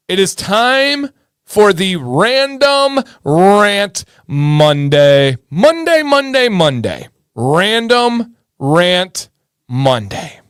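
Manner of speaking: slow, stretched announcer intro; hammed-up jingle announcement of Random Rant Monday; show segment announcer. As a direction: sing out the segment name. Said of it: "This is where I rant".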